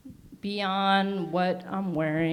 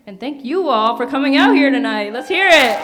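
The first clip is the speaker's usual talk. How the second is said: hype shout; 5 semitones higher, 12 dB louder, faster than usual. Real, not acted. Calls for crowd applause.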